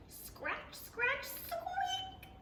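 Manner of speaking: high squeaky scratch and squeak; tiny mouse noises up high; squeaky mouse sounds. A narrator's reading. Storyteller voicing a mouse scratching and squeaking.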